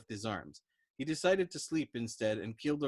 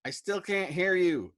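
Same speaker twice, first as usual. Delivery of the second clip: chiding; 8 semitones higher, 8 dB louder than usual. Acted. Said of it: "Arfie chided".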